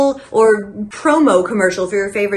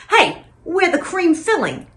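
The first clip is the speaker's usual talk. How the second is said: higher-pitched Brooklyn cartoon shark; Brooklyn accent; 7 semitones higher than usual. Shark turning to the camera at ad's end, Brooklyn accent. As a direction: say it as a Brooklyn tough-talking shark. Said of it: "in a Brooklyn accent and he goes".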